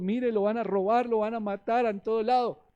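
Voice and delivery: high, loud imitation of worried parents; fearful parents' warning. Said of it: "Así era mi papá, así era mi mamá".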